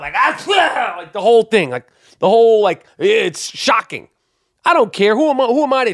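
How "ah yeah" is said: high, explosive fake sneeze; loud mock sneeze. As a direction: imitate a huge sneeze. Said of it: "like that loud".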